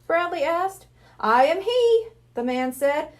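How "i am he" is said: grand showman. Acted. Hypnotist's grand introduction.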